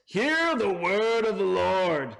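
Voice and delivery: a proud herald declaring the word; chest-out confident. Shown as a demonstration. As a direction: announce it boldly with your chest out. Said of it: "don't imagine Samuel saying this with his chest out, confident voice".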